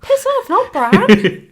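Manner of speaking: loud, slow, high protest; indignant. Real, not acted. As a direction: drag it out in outrage. Indignant at Bran.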